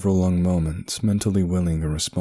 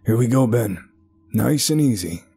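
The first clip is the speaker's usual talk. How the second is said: raised, steady call down a shaft; 5 semitones higher, 3 dB louder than usual. A narrator's reading. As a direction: call down encouragingly to a trapped boy. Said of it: "he called down to the trapped boy".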